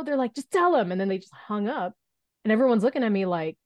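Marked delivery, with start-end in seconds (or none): 0.3-0.9 high, louder mock snap; retold yell. Caller to the hesitant intern before hanging up, mock yell. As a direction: imitate an impatient caller's yelled order. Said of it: "someone just yells at me".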